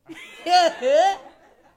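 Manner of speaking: loud, gloating villain laugh; big menacing laugh; evil laugh. Retold or imitated. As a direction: cackle like you've won. Gloating laugh after threat.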